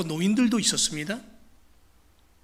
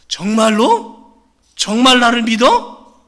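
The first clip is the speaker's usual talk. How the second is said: probing God; 3 semitones higher, 9 dB louder, slower than usual. Acted. God presses the man's faith.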